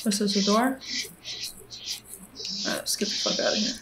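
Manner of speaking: fast, unvoiced whisper. Real, not acted. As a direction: hurry out in a whisper. Sneaking out past monster.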